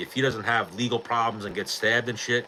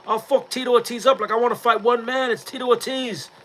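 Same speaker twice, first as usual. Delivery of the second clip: very high-pitched mock fighter's call-out; Lee Murray; 12 semitones higher than usual. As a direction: imitate a cocky fighter challenging his rival. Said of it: "and he was like".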